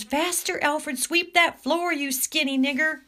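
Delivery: high, loud screeched order; screaming. Acted. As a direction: screech it like a bossy eagle. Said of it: "The eagle screams".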